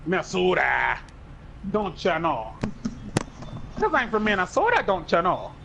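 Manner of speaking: hammed-up folksy Minnesota accent. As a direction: lean into a mock Minnesota accent. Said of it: "that's the stereotype I have".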